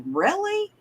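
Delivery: high, soft incredulous question; soft, high-pitched "oh come on" query; retold disbelief. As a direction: squeak it quietly in mock disbelief. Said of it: "And I'm just like".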